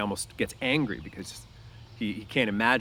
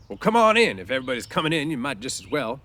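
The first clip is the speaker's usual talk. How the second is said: louder, higher gruff call, pleased underneath; mock gruff invite; 4 semitones higher, 7 dB louder than usual. Crooks invites Candy in, gruff to hide his pleasure. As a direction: bark it to hide being pleased. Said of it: "conceal his pleasure with anger".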